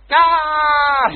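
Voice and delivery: high, loud excited shriek; excited scream. Retold or imitated. Fiancée opening a marriage proposal letter, happy scream. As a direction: let out a sharp scream of delight.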